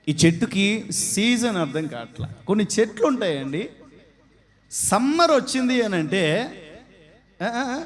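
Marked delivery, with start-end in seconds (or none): none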